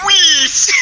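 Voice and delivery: "Weest" squeaked high and loud with a long ee; mocking weest. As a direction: parrot "Weest" back, high, loud and teasing. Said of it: "I thought you said weest".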